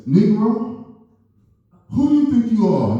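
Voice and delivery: high, loud mock outrage; yelling it like an angry stranger; hammed-up angry holler. Angry man confronting him.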